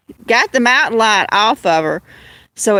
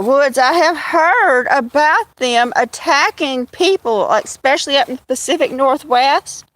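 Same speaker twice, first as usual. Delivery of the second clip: ordinary talk, nothing marked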